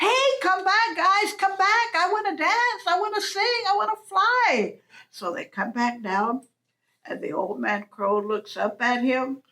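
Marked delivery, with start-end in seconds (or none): 0.1-4.8 high pleading call after the flock. Acted. Coyote begging the crows to come back, high calling voice. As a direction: call them back, pitched way up.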